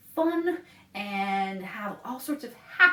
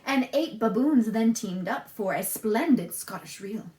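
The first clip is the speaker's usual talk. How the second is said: lilting rhymed storybook read; reading to children; 4 semitones lower than usual. A narrator's reading. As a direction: read the rhymes to little listeners. Reads picture book aloud.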